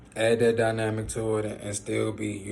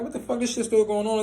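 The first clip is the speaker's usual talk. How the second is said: much higher, startled outcry; rising panic; 12 semitones higher, 4 dB louder than usual. Retold or imitated. Still shaking on the porch, startled high outcry. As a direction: voice jumps up as the shaking won't stop.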